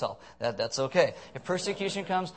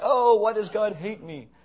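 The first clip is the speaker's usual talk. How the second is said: high, loud, drawn-out whine to God; mock complaint; 6 semitones higher, 7 dB louder, slower than usual. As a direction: wail it slowly in mock self-pity. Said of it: "we're like".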